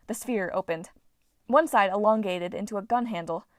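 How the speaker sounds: usual speaking voice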